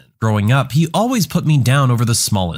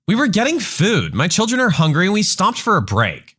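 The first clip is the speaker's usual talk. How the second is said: higher, indignant entitled mom; entitled mother; 7 semitones higher than usual. Acted. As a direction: sound put out and self-righteous, pitched up. Entitled mother answers the driver.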